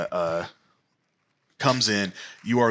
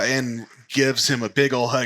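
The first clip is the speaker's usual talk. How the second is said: ordinary talk, nothing marked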